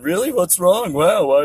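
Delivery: high, fake-concerned gawking; mock worried people gawking. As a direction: ape bystanders pretending not to know what's wrong. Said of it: "They're going".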